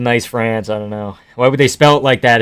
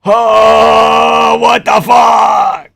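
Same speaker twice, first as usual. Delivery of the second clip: higher, loud, slow disbelieving yell; outraged shout; 8 semitones higher, 5 dB louder, much slower than usual. Real, not acted. Outraged at the fight result.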